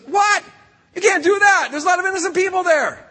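Abraham shocked, protesting loudly; shocked outcry. Acted. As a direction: cry out in disbelief and protest. Abraham reacts to the news.

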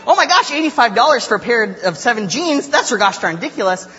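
high, loud mock amazement held to the end; amazed friend. Friend Claire marveling at the bargain, mock-delighted exclamation. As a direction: imitate a bargain hunter thrilled by the deal. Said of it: "And Claire's like".

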